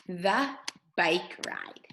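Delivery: "The bike ride" read slowly, word by word; slow, careful teacher read-aloud; slow model reading. Shown as a demonstration. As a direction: model each word for the child to copy. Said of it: "I'm going to read the words".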